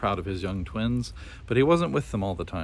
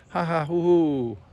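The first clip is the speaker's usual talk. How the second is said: high hooting bird laugh, slow and loud; kookaburra laugh; 8 semitones higher, 4 dB louder, slower than usual. A narrator's reading. Kookaburra showing off to the bears, laughing. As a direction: cackle like a kookaburra. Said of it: "laughed the kookaburra".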